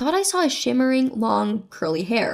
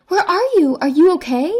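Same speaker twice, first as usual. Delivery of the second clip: louder, higher worried questions; raised voice, anxious to confirm safety; half shouting; 5 semitones higher, 6 dB louder than usual. A narrator's reading. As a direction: half-shout it, urgently checking he's safe. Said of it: "who was half shouting to confirm his safety".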